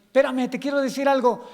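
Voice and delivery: acted urgent interruption; God interrupting. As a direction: cut across the praying to get a word in. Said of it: "Y el Señor".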